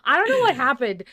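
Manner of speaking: laughing gush. Real, not acted. Gushing about missing Cain.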